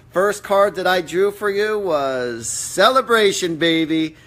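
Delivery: loud, high, drawn-out reveal; excited announcement. Real, not acted. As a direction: announce the lucky card triumphantly. Delightedly announces a lucky card.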